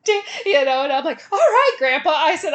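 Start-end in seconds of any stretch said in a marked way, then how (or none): 0.0-0.2 loud, high drawn-out cursing; angry curse. Retold or imitated. Grandpa cursing. 1.3-2.1 placating. Retold or imitated. Placating angry grandpa.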